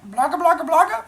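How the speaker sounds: high, loud nonsense gibberish; babbling monsters. Acted. Imitates the monsters' babbling speech.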